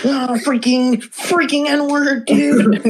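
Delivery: high, slow whiny mock voice, straining at "freaking"; mocking Alex. Mocking a streamer who is desperate to say the word, high and whiny. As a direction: play him as a frustrated kid who can't say it.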